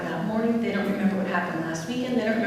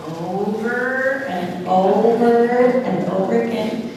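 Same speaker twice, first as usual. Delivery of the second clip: slow and slightly louder, stretching each over; slow drawn-out repetition; 4 dB louder, much slower than usual. Shown as a demonstration. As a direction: drag out the repetition to show its tedium. Stressing endless repetition.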